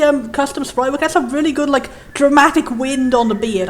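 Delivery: normal talking voice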